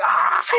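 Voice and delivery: a sudden loud cry of dismay; despairing cry. Retold or imitated. Realizing the march loops back to the landing strip, a loud cry. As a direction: let out a short anguished yell. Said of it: "And it's like".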